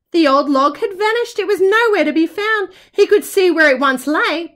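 ordinary delivery

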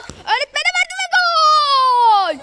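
goal cry; screaming football commentator. Acted. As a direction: shout the goal with a cracking cry.

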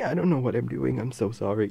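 ordinary delivery

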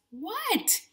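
high-pitched cry of surprise without volume; mock shout. A narrator's reading. Animals answering the little boy, mock shouting. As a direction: exclaim it high, a restrained shout. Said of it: "shouted all the animals".

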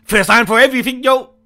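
very loud, high, excited shouting; hyped-up reaction. Real, not acted. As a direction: holler it high, thrilled and shocked. Excited reaction to bathing scene.

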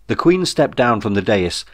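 usual speaking voice

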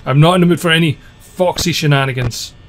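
ordinary talk, nothing marked

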